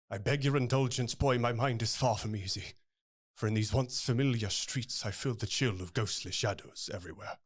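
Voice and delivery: lightly voiced dark barber reciting his past; Sweeney Todd lines. Shown as a demonstration. Actor gives a taste of Sweeney to the hosts, lightly brooding. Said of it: "a little bit of Sweeney".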